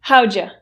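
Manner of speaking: How'd ya run together as howja; reduced form. Shown as a demonstration. Teacher modelling the reduction howja. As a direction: say How'd ya as one quick word, howja. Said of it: "See how much easier that is to say".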